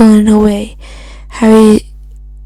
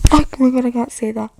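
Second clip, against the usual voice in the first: breaking into embarrassed laughter, much softer; laughing; 3 semitones higher, 8 dB quieter, much faster than usual. Real, not acted. Reader caught off guard by a word, laughing softly. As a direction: reader cracks up mid-line and stops herself.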